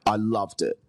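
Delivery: normal talking voice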